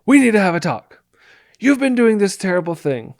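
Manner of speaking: accusing tone. Shown as a demonstration. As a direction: launch the talk like an attack. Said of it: "You can't start talking like this".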